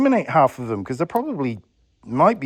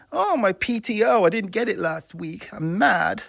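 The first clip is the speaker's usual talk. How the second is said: higher-pitched mock grumbling; mock-aggrieved worker; 7 semitones higher than usual. Vlogger imitating a disgruntled company worker, mock-aggrieved. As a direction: imitate a complaining employee, pitched up.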